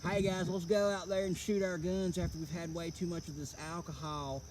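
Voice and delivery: lightly mocking drunk party guy; tossing off a lazy mock of the neighbours. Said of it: "my impersonation of these people".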